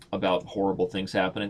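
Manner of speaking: ordinary talk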